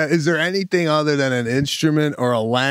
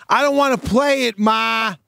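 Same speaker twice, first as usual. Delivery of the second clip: put-on whining kid; 9 semitones higher than usual. Son begged to play for the Johnsons, whining. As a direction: complain like a kid put on the spot. Acted kid refusing mother's request.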